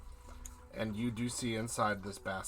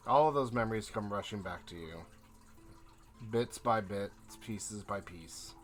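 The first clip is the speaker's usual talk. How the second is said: scene narration; 10 dB louder than usual. A narrator's reading. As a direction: paint the memory unhurriedly, letting lines trail off. GM sets the scene.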